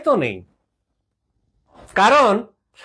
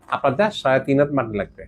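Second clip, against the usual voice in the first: normal talking voice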